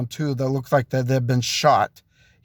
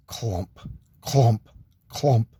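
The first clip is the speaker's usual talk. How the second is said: low, heavy "clomp", each one separate; imitated footsteps; 5 semitones lower, slower than usual. Storyteller imitating someone coming through the house, low clomps. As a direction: drop low for each footfall sound.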